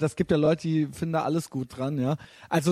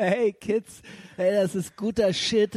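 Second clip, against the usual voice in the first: put-on stoned old guy; 4 semitones higher, slower than usual. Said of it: "Nullerjahre Kifferhumor".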